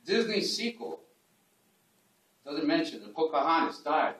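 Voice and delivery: measured poem recital, pitch lifting on key lines; declaimed verse with pauses between lines; poetry reading. Acted. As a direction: read it aloud as a poem to a room, each line set apart.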